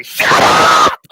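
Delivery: loud hoarse yell of "Shut up!"; unhinged shout. Retold or imitated. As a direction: explode at her with a wild yell. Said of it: "I just let out an unhinged shout".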